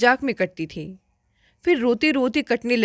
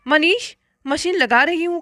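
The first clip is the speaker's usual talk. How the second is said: higher call, as if to someone in another room; 6 semitones higher than usual. A narrator's reading. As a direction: call across the house, raised voice. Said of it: "भाभी ने आवाज़ लगाई".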